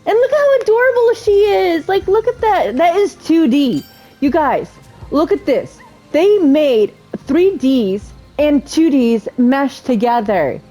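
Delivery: high-pitched delighted marvelling; real, high, wowed enthusiasm; excited gushing. Real, not acted. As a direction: gush to viewers about something adorable. Gushes over the capybara's look.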